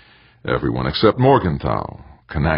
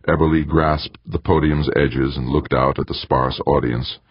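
ordinary delivery, nothing marked

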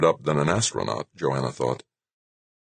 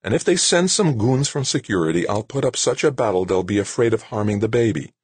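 higher, determined voice of Claire; defiant young wife; 7 semitones higher than usual. A narrator's reading. Claire vowing to fight off security, defiant. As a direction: dare them to try.

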